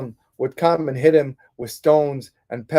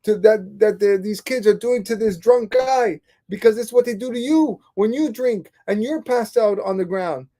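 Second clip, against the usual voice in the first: much higher, pleading in disbelief; son's exasperated higher cry to his father; disbelieving protest; 9 semitones higher than usual. Retold or imitated. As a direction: plead with your father, stunned he missed the point. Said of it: "his son couldn't believe it".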